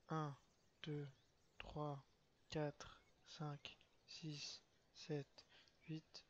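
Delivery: soft, half-voiced counting; whispered count. Real, not acted. Streamer timing an ability, counting in a whisper. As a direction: whisper the numbers to yourself. Counting seconds under breath.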